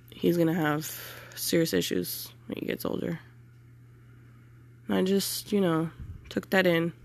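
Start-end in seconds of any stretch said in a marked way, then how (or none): 0.1-3.2 hushed, fighting tears; near-whisper. Real, not acted. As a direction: let it drop to a whisper. Retelling doctors' warning, near tears.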